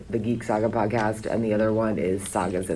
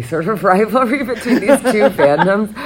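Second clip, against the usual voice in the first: laughing; 10 semitones higher, 9 dB louder than usual. Real, not acted. Amused by the fan rivalry.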